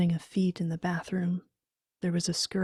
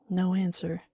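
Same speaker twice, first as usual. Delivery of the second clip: plain reading voice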